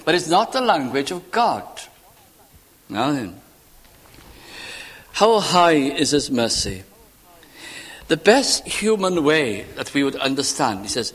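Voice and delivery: ordinary talk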